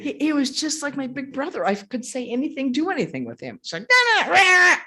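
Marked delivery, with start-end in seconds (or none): 3.9-4.8 very high, loud mock shriek; squabbling shout. Squabbling with him like a big brother, mock shouting. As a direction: mimic siblings bickering at full pitch.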